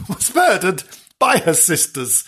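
telling it through laughter; giggling over the grim twist; laughing as she tells it. Real, not acted.

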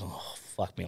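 soft, deflated aside to himself; retold sinking dread. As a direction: let it drop softly, heart sinking. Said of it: "my heart's falling out my ass".

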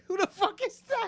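high-pitched Sebastian voice; Sebastian impression. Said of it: "Sebastian is".

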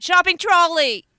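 high, loud mock screech; mock scream. Shown as a demonstration. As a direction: scream it as a joke across a car park. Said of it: "screaming in a car park".

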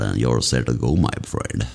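ordinary talk, nothing marked

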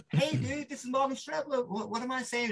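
high-pitched mock video greeting; Morgan Strebler. Morgan records a message for a friend, high mock greeting. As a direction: send a message in a high put-on voice.

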